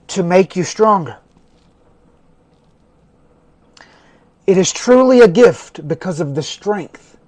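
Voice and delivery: slow recitation with long pauses between phrases; measured, spaced-out mantra reading; said slowly. Shown as a demonstration. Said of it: "let's say it slowly".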